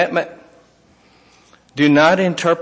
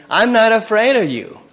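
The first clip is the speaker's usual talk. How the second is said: higher and louder, bold defiance; defiant Joseph; 6 semitones higher, 4 dB louder than usual. Acted. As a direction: throw it at the king fearlessly. Joseph defying Pharaoh, voiced.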